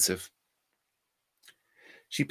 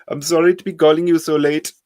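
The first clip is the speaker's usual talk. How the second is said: put-on high, loud scam-caller accent; heavy Indian accent; 7 semitones higher, 7 dB louder than usual. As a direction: play a pushy call-centre scammer. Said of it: "heavily accented voice".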